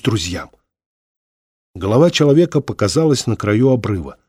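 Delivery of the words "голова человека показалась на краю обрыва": plain reading voice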